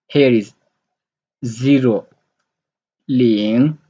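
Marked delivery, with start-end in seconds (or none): 3.1-3.8 Ling in Mandarin second tone, dipping then rising. Shown as a demonstration. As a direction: let Ling climb at the end like a question. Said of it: "the second tone".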